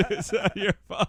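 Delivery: high, loud, breaking into laughs; can't keep a straight face; laughing through it. Pretend.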